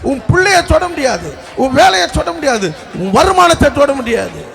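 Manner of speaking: high-pitched, insistent preaching declaration; forceful preaching. Real, not acted. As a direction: proclaim it over the congregation, line after line. Preacher declares protection over congregation.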